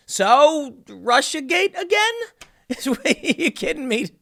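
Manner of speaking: can't believe it, laugh it out high; half-laughing disbelief at the news; incredulous. Real, not acted. Incredulous at third scandal.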